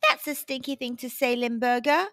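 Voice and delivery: ordinary delivery, nothing marked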